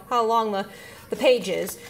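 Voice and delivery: ordinary talk, nothing marked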